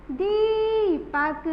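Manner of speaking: Deepak slowly with दी held long; long दी sound. Shown as a demonstration. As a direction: stretch the ee of Deepak. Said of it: "उसका sound लंबा". (दीपक में दी लंबी खींचकर)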